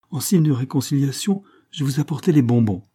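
all smiles, overly friendly; a cheerful man making peace with an old lady; jovial. A narrator's reading. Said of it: "la figure toute réjouie".

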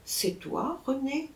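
woman waking, murmuring to her former husband; murmured. A narrator's reading. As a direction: barely breathe the question. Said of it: "Elle murmura mon nom".

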